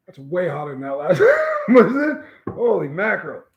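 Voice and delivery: slow, strained, half-laughing through real burn; overwhelmed by heat. Real, not acted. Taster reacting to hotter pepper, reeling and laughing.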